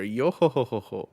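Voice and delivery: hearty singsong laugh in a put-on voice; weird laugh. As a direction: laugh it out like a jolly skeleton. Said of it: "you got a weird laugh".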